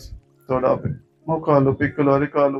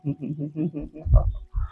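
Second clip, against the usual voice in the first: soft rippling closed-mouth laugh; long, hushed little laugh; soft chuckle; 6 dB quieter than usual. Real, not acted. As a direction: chuckle quietly as you wind down. Chuckles while closing meditation.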